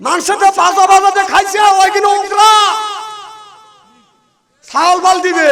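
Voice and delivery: high, loud outburst; shouted scolding. Real, not acted. Preacher berating people over debts, shouted scolding.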